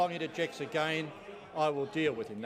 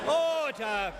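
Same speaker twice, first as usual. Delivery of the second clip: shouting order order; 7 semitones higher than usual. Real, not acted. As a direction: bellow to silence the chamber. Speaker shouting over uproar.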